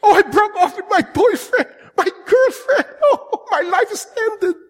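high, whiny mock-wail with laughter; hammed-up heartbroken teen. Preacher mocking a teen after a breakup, whiny wail. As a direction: overdo the breakup despair.

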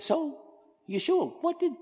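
high, bright interviewer question. Retold or imitated. Megan interviewing Yeshua, high eager host voice. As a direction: lift it like a curious TV host. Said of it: "Megan is sitting down with Yeshua".

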